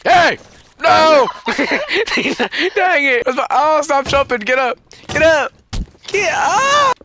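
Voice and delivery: high, loud shouts with laughter breaking in; frantic yelling. Pretend. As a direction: yell urgently at a misbehaving kid. Frantic at child in game.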